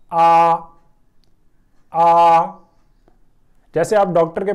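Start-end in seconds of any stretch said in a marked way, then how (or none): 0.1-2.5 आ as a long, loud, open "aah". Shown as a demonstration. Teacher showing learners how to read the first final, long loud आ. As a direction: hold आ steady and loud, like Urdu alif madd. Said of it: "अलिफ मद आ".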